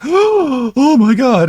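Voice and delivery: very high, very slow, hammed-up horror; Movie Bob's theatrical shock at being insulted; mock shocked gasp. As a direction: clutch your pearls in fake outrage.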